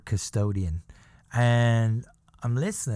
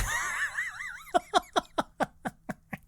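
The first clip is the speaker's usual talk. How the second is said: burst of laughter; 3 dB quieter than usual. Real, not acted. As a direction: crack up at the memory. Laughing at own story.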